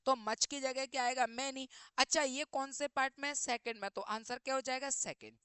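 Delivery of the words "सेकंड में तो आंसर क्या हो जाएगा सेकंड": normal talking voice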